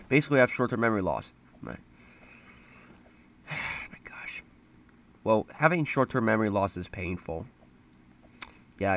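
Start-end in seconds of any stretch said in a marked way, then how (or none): 1.6-4.4 whispered sigh. Real, not acted. Exasperated at memory loss.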